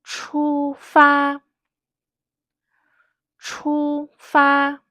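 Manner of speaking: "Chu" and "fa" both held high and level; two long, flat, high syllables, "Chu fa"; first tone twice. Shown as a demonstration. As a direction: hold "Chu fa" on one high, steady note. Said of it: "First tone, first tone".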